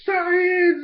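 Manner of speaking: high, loud, hammed-up war cry; screaming battle cry. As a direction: scream it mid-jump. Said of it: "just screams".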